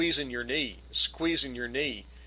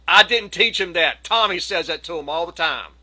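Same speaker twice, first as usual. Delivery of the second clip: loud, defensive denial, retold; higher, emphatic blame-shifting; loud protest; 3 semitones higher, 8 dB louder than usual. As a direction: protest your innocence at full volume.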